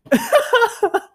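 real high burst of laughter; cracking up. Real, not acted. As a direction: break into high, helpless laughter. Laughs at own bit.

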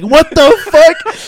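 very high, loud, stretched-out shriek of disbelief; incredulous yell. Retold or imitated. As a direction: yell it slowly, reliving the amazing shot. Climax of story, made shot.

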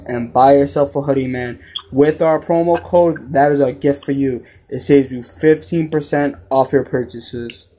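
podcast sponsor spot; ad read. Acted. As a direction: sell the hoodie deal to the fans. Promo code ad read.

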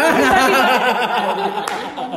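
high, loud burst of laughter. Real, not acted. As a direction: laugh out loud, caught off guard. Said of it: "Jangan lari-lari dulu".

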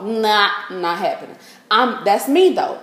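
ordinary delivery

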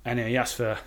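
put-on chatty anecdote in a convincing Scottish accent. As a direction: tell it casually as a Scot. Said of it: "I'm not even Scottish".

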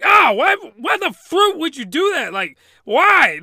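loud, high, outraged yelp; hammed-up wail of "why"; shocked protest. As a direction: protest in mock disbelief. Voicing maid shocked by ice.

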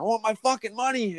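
high strained demand; retold demand. Worker demanding his pay at the boss's house, high. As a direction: bark for your money.

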